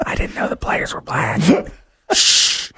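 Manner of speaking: hushed secretive whisper. Pretend. Cohost whispering a joke about the old days.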